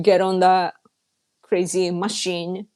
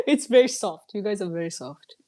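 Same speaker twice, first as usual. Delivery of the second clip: usual speaking voice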